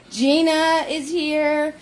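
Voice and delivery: slow, high name announcements; slow roll-call announcing; roll-call announce. Real, not acted. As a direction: announce each name like arrivals.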